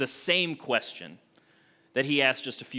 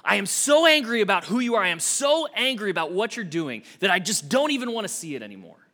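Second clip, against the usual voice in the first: voicing Jonah's pent-up anger, pushed higher; the floodgates burst, let it pour out; angry outburst; 6 semitones higher, 4 dB louder than usual. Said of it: "he can't hold in his anger anymore".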